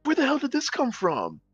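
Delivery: high, startled whoa; retold amazement at her clear English; astonished. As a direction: act stunned. Retelling his surprise.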